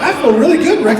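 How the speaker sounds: high, laughing, wired reply; hyped-up buzz. Retold or imitated. Teammate answering Rick, a giddy high laughing voice. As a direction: play the buddy buzzing on caffeine.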